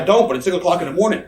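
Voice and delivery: loud, high, rapid-fire excuse; exasperated protest. Retold or imitated. Wrestler retelling a six o'clock phone call, exasperated protest. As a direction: blurt it out in comic exasperation.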